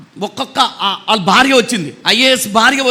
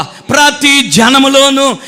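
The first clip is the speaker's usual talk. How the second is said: full-force preaching; 3 semitones higher than usual. Acted. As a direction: drive each phrase home, slowly.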